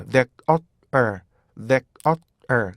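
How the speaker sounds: "that, uh, er" as three separate beats. Shown as a demonstration. Teacher splitting the word into three beats. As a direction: split "there" into "that, uh, er". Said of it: "입을 앞으로 쭉 내밀면서".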